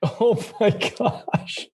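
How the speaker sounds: high, drawn-out words through laughter; laughing amazement. Real, not acted. Listener reacting to the nitroglycerin patch story, laughing amazement. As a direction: laugh out your disbelief, stretching the words.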